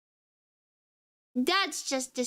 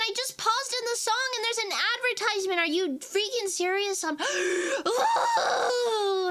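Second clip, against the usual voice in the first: much higher, indignant shout at the ad; outraged outburst; 8 semitones higher than usual. Real, not acted. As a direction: blurt it out, furious about the ad. Outraged at an advertisement.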